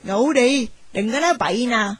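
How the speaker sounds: put-on aunt scolding the girl to sleep. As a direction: hush her down like a stern aunt. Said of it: "rồi la rằng".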